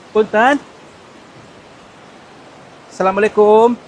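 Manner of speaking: loud greeting into the cave; loud salam into the cave; calling out. Real, not acted. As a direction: call out to whoever lives here.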